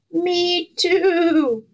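high, bleating little goat voice. A narrator's reading. Goat agreeing with the cow, high bleat. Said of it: "bleated the goat".

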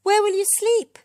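very high, loud cry; high-pitched, anxious shout. A narrator's reading. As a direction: cry out the question in worry. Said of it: "cried Wembley".

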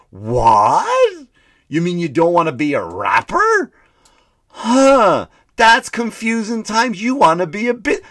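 high, loud put-on voice, slow on "confusing times"; a bewildered old man, hammed up; mock Biden baffled. Comic continuing the Biden impression, high baffled put-on voice.

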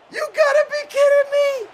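very high squealing shout; disbelieving yell. Real, not acted. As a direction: scream it in disbelief. Reacting to Draxler miss.

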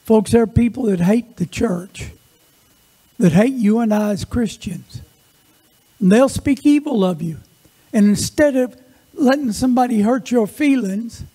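normal talking voice

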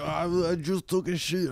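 hammed-up toilet strain; mock straining man. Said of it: "He's like".

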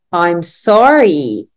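drawn-out slow model line; repeat-after-me line. Shown as a demonstration. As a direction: stretch it so they can copy. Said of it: "Repeat after me".

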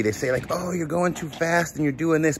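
usual speaking voice

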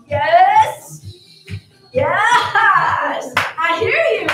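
loud encouraging yes; cheering shout. Real, not acted. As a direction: shout it to the class. Instructor cheering class on.

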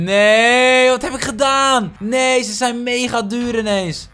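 real shocked yell, high and loud; shocked cry. Real, not acted. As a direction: cry out in dismay at the mistake. Villagers suddenly expensive, panics.

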